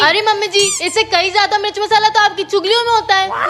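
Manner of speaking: ordinary delivery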